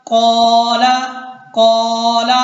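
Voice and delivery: "कौला" with a long-held, loud first vowel; stretched vowel. Shown as a demonstration. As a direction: draw out "कौ" on a level note in "कौला". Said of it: "हम कौफ को खींच रहे हैं".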